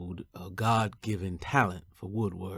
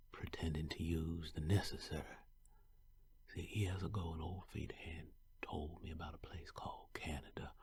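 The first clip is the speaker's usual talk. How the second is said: hushed story narration; 8 dB quieter, faster than usual. A narrator's reading. Runaway slave whispering his escape to the listener, hushed. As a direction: tell it softly like a secret kept in the dark.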